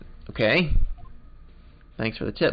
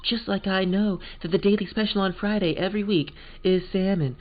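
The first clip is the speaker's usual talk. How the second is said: higher, dreamy posh American lady; wistful posh American; 7 semitones higher than usual. Acted. Angel Starr musing about destiny, posh American. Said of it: "posh American".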